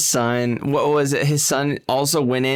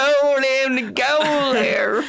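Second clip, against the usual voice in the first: high, slow hammed-up whimper; much higher mock weeping; mock sobbing; 12 semitones higher, slower than usual. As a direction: whine it like a fake sob. Mocking the crying news lady.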